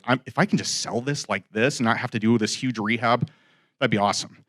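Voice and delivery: low, soft aside to oneself; hopeful musing. Retold or imitated. Seller privately hoping to skip the rehab, hopeful musing. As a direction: drop down softly, thinking aloud. Retold private hope about reselling.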